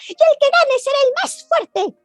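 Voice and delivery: high, cheeky rabbit voice; storyteller voicing a bunny; little rabbit voice. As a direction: play the small bold rabbit.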